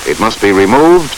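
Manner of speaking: ordinary talk, nothing marked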